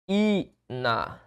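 "ina" as a held í, pause, na; syllable split. Shown as a demonstration. Teacher dividing cafeína, "ina" in two detached pieces. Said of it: "a separação de cafeína é".

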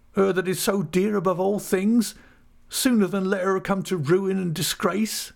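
high-pitched, anguished countryman; distraught rustic voice, pitched high; heartbroken Ham. Acted. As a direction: pour out grief for a lost love.

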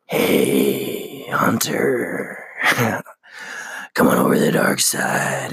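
whispered, sly tempting voice; a hint of a sinister whisper with a little cackle; tempting inner voice. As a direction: lure him to the dark side, half-whispered. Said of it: "this voice is looking out for me".

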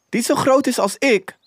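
put-on high-pitched little boy's question; young boy, questioning. A narrator's reading. Boy asks Scrooge about the turkey, high boyish question. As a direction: ask it high, like a small boy.

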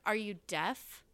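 slow, contemptuous are you deaf; retelling rude passenger, slow sneering; rude snap. Retold or imitated. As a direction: sneer it slowly like a rude man. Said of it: "And he's like".

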